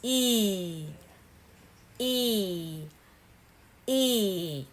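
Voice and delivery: long ee with lips spread wide in a smile, E; long E sound. Shown as a demonstration. Teacher modelling the long E for learners, drawn out. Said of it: "now, you say".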